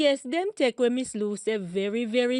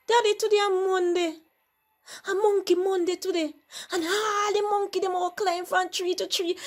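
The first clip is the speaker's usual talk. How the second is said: son voice; 7 semitones higher, faster than usual. Acted. Son pleading excuses.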